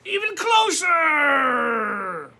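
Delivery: slow, loud drawn-out booming dark. Acted. The dark beckons the boy closer, slow and booming. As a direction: stretch it out, huge and looming. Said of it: "said the dark".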